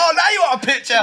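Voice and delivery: high, loud mock scorn; sarcastic jab. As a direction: throw it back sarcastically.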